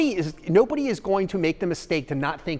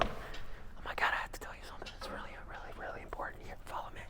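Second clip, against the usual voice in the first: urgent secretive whisper into the phone; 18 dB quieter than usual. Pretend. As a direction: whisper like sharing a big secret. Acting out a phone video hook.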